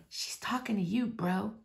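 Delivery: hushed tip-off from behind; whisper. Acted. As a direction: lean in and whisper the warning. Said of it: "your best friend whispers from behind".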